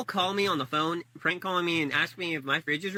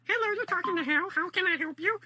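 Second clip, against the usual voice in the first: much higher put-on prank-call voice, squeaky and silly; 12 semitones higher than usual. Prank caller greets the call in a much higher squeaky voice. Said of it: "some random off-the-handle thing like".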